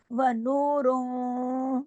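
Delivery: slow melodic tajweed chant; slow Quranic recitation with held vowels. Shown as a demonstration. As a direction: recite the verse slowly, stretching each vowel.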